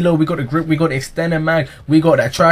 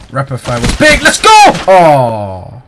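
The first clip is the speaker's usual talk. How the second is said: normal talking voice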